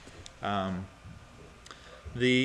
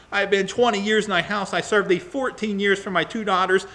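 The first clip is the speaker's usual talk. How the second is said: high, indignant litany of grievances; angry tirade; 4 semitones higher, 3 dB louder than usual. Acted. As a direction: read it as a wronged man's mounting complaint. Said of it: "going into a tirade".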